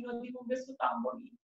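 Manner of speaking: ordinary delivery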